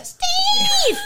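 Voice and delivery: high, loud laughing cry of Steve's name; loud outburst. Real, not acted. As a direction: burst out yelling his name.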